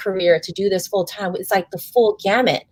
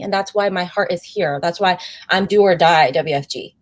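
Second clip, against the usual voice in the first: ordinary delivery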